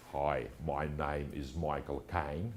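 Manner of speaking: put-on low, soft, slow working-class British accent; slow, quiet Cockney impression, pitched well down; Michael Caine. Lecturer showing students his Google accent results, working-class British accent impression. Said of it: "working class accent".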